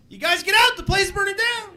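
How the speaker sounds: high yelled warning, strangely offhand; mismatched face voice. Acted. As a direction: blurt it up high with no alarm. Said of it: "a guy who doesn't know how to match his face to what he's saying".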